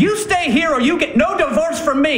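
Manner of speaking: high, forceful order; angry ultimatum. Acted. Husband stopping wife going to bed, angry ultimatum. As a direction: lay down the law, outraged.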